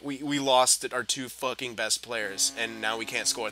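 normal talking voice

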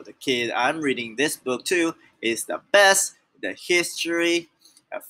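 ordinary delivery, nothing marked

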